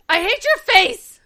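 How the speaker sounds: high, slow mock tantrum; childish outburst. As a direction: pout it out like a sulky kid. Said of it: "Cash just goes".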